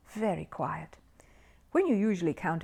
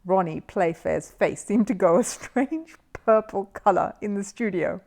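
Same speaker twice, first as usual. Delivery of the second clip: usual speaking voice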